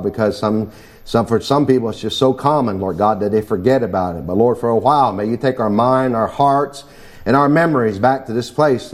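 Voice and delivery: low, earnest pulpit prayer. Acted. Pastor praying over the congregation before preaching, low and devout. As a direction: pray it low and devout, calling on God.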